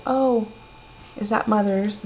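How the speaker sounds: a touch of delighted discovery; pleased surprise. Retold or imitated. Daughter noticing her mother's hot water bottle, lightly surprised.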